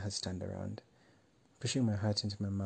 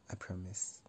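soft unvoiced "I promise"; whispered promise; 7 dB quieter than usual. A narrator's reading. Marcos whispering a flirty promise to Ali. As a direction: breathe "I promise" like a secret.

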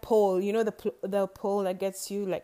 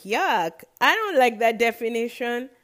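louder, acted-out disgust; disgusted reaction; 2 semitones higher, 7 dB louder than usual. Podcaster retelling their reaction to Google's definition, loudly disgusted. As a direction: push back loudly with distaste.

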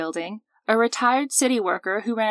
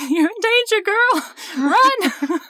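high, loud sassy warning; sassy movie warning; 12 semitones higher, 5 dB louder, slower than usual. Pretend. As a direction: shriek it like a psychic warning a friend. Joking movie-quote warning.

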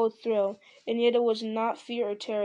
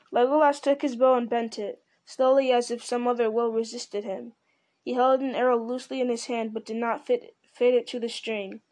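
ordinary delivery, nothing marked

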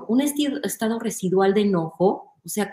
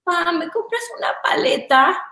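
put-on much higher sweet child's ask; little girl begging her dad for a lollipop, higher voice; 9 semitones higher than usual. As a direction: plead to daddy like a small girl. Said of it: "pensemos en la niña chiquita".

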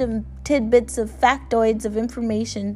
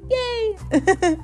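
high cheerful yay; excited; 11 semitones higher than usual. Real, not acted. Cheering the listeners.

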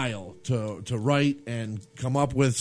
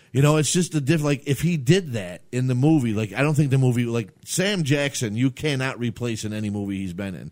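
ordinary delivery

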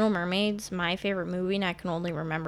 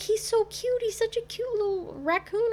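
much higher cooing voice; cooing over cute raccoon. Retold or imitated. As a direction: coo like at a cute pet. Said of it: "They're like".

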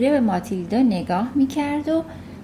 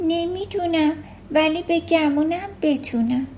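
put-on lightly raised little-girl voice, earnest; a young girl owning up, higher; Matilda's voice; 6 semitones higher than usual. A narrator's reading. Matilda admits to her teacher, lightly voiced girl.

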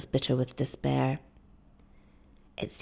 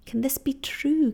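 whispered much higher in disbelief; stunned whisper; 9 semitones higher than usual. Acted. Kiawe learning the boatswain won't sell, shocked whisper. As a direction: breathe it out in shock, no voice. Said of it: "Kiawe cried".

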